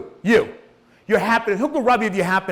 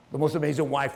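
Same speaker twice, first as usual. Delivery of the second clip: usual speaking voice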